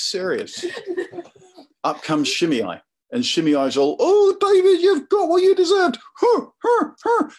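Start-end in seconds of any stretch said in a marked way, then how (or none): 0.0-1.8 laughing through the words, caught out; cracking up mid-sentence. Real, not acted. Preacher laughing at his own acting to the congregation. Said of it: "don't laugh". 4.0-7.3 much higher, loud jeering cackle; Shimei taunting. Acted. Shimei jeers at the fleeing king, high and loud. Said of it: "I'm just gonna quickly act out".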